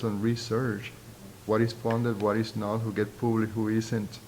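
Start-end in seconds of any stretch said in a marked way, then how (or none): none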